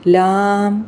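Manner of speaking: "لام" with a long held aa; long madd on "لام"; lengthened laam. Shown as a demonstration. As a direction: stretch the vowel of "لام". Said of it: "لام کو لمبا کریں گے".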